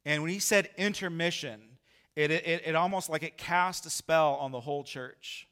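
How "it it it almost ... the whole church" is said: ordinary talk, nothing marked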